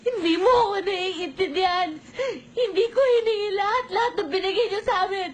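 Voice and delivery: crying. Acted. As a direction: sob it out in protest. Daughter crying out. (umiiyak na pagsigaw)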